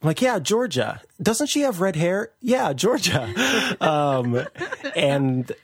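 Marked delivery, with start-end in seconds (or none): 0.2-2.3 lightly voiced coworker, higher; a casual office voice, pitched up; imitating coworkers. Coworkers quoted about Georgia. 2.4-3.3 lightly voiced coworker, higher; imitating coworkers. Coworkers quoted again.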